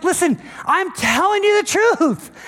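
high, insistent exasperated pleading; acted urgent exasperation, voice pushed up high; frustrated Jesus. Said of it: "he's frustrated".

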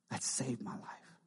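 breathy near-whisper, slow; a slow, hushed whisper of gratitude. Real, not acted. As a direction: let it fall to a hushed whisper. Final repeat, whispered.

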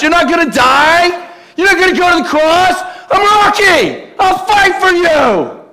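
high, loud, slow street-tough shouting; Peter as Rocky. Retold or imitated. Peter refuses to let Jesus go to the cross, shouting as Rocky. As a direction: shout it like a brawler defending his friend. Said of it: "Peter jumped in now that he's Rocky".

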